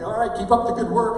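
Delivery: high, hurried-off pep line; mock encouragement to get away; awkward encouragement. Retold awkward exit from chat.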